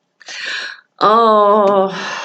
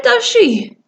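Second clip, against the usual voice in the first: ordinary delivery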